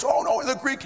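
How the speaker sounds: high, brushing it off; dismissive professor. Retold or imitated. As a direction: wave the objection away. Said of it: "and he goes".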